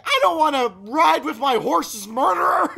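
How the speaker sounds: high, loud accusing yell; reenacted, hammed-up furious refusal; outraged shout. Retold accusation at friends.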